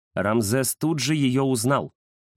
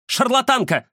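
loud, high-pitched indignant cry; angry accusation; 14 semitones higher, 5 dB louder than usual. A narrator's reading. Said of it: "рассердилась Варвара Коновалова". (сердитый выкрик высоким голосом)

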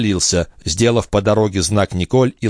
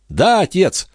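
higher-pitched eager affirmation; excited son; 10 semitones higher, slower than usual. A narrator's reading. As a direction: confirm it eagerly to a doubting father. Son greets doubting father. (взволнованно, приподнято)

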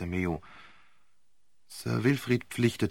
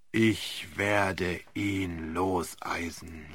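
low, very slow, softer; grim, determined promise; grim resolve; 6 semitones lower, 6 dB quieter, much slower than usual. A narrator's reading. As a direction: barrister grimly vowing to free him. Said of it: "lautete die grimmige Antwort".

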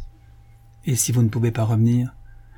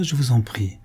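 ordinary delivery, nothing marked

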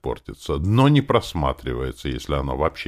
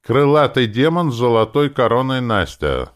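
loud, high official proclamation; strict formal summons; stern; 9 semitones higher, 8 dB louder than usual. A narrator's reading. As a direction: address her strictly by full title. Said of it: "начал я строго".

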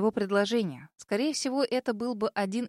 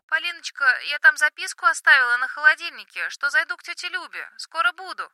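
higher, louder fussing mom; chatty mother on the phone, raised pitch; mother's voice; 6 semitones higher, 8 dB louder than usual. Acted.

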